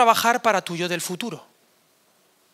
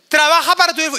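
loud exhortation; 7 semitones higher, 6 dB louder, faster than usual. Retold or imitated. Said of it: "yo les digo".

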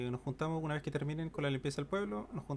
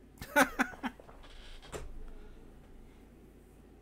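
laughing. Real, not acted. Laughs at a joke.

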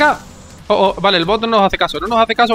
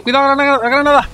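grenade warning yell; 6 semitones higher than usual. Real, not acted.

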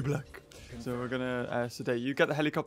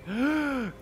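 high, sharp intake of breath; wowed little gasp; excited gasp; 7 semitones higher, 3 dB quieter than usual. Real, not acted. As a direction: gasp in delight. Delighted at new skins.

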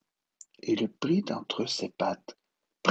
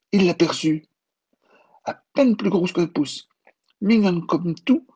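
higher, louder, marvelling; tender wonder; 10 semitones higher, 8 dB louder than usual. A narrator's reading. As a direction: narrate the boy's delight at the tiny mouse. L'enfant découvre la souris. (émerveillement tendre, plus aigu)

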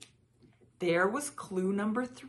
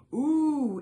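delighted ooh; 8 semitones higher, 10 dB louder than usual. A narrator's reading. Willis enjoying warmth.

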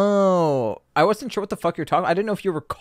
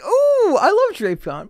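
excited squeal; 5 dB louder, slower than usual. Retold or imitated. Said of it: "I was like".

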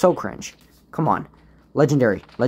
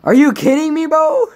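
high, loud outraged shout; outraged yell; 8 dB louder than usual. Real, not acted. Gamer yelling at the teammate who took his sniper, outraged shout. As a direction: yell it at the thief in disbelief.

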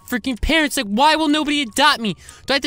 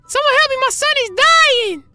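fake panicked cry for help; 12 semitones higher, 4 dB louder than usual.

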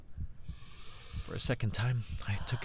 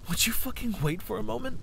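sharp, frustrated snap; outburst; 8 semitones higher than usual. A narrator's reading. Arthur snaps angrily at Aldara to wait. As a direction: cut her off in anger. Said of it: "My sudden outburst scared her".

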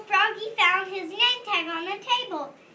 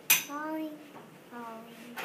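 falling away to much softer; fading softly; 8 dB quieter than usual. A narrator's reading. Narrator picturing Froggy drifting like a leaf, fading softer. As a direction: let the words drift down and fade.

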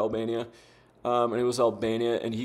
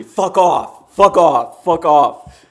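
fuck off, shouted louder each time; real furious outburst; angry shouting; 4 semitones higher, 10 dB louder, much slower than usual. Real, not acted. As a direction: blast it at the opponent. Angry at rude opponent, repeated.

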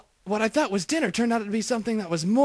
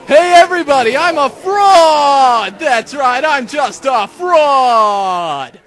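loud, drawn-out shouted confession, higher; mock public outcry; 4 semitones higher, 12 dB louder, much slower than usual. Sheriff mocking the detective to the crowd, loud drawn-out shout. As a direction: bellow it to the whole crowd, slowly.

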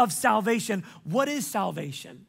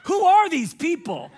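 amazed disbelief; 7 semitones higher, 6 dB louder than usual. Retold or imitated. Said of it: "And you're like".

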